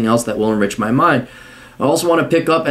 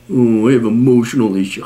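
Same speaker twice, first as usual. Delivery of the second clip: lower-pitched put-on moan; mocking imitation; 4 semitones lower than usual. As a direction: moan the excuse with contempt. Mocks whiner's excuse.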